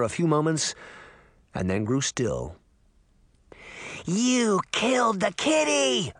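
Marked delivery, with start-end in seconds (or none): 4.0-6.1 high, slow, whining outrage; childish rage. Acted. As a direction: wail at him like a spoiled child.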